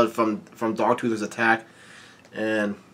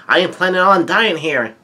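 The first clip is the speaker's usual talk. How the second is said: higher, louder, defiant declaration; bold statement; 6 semitones higher, 5 dB louder than usual. Acted. Luffy refusing to die here, boldly defiant. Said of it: "Luffy makes a bold statement".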